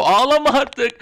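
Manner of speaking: loud, high outburst of frustration; exasperated scolding. Acted. Telling champion Bianca to stop crying, exasperated shout. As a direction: burst out, fed up, at the absent wrestler.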